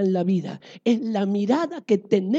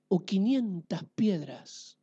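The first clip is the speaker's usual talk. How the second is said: normal talking voice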